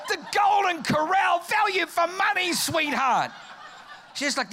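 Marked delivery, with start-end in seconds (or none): 0.1-3.3 loud, high-pitched mock enthusiasm; big comic exclamation; hyped-up husband. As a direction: sell it to the wife at near-shouting pitch. Husband hyping a buffet to wife.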